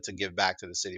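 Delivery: ordinary talk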